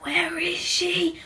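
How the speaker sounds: soft, slow whisper. Acted. Reporter looking for the missing weather girl, whispering. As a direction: hush it like a secret.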